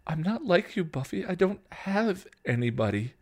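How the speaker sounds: high, pleading protest; tearful yelling back. A narrator's reading. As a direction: argue back through tears, breaking off. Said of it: "Dawn yelled back, tears spilling over".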